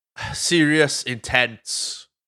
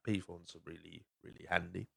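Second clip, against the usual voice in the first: near-murmured aside, far softer; much softer repeat; 4 semitones lower, 18 dB quieter than usual. Real, not acted. Podcaster repeating his payphone view to listeners, almost murmured.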